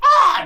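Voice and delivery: sudden high loud squawk of a spooked bird; startled bird squawk. Retold or imitated. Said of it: "Some of the birds are like".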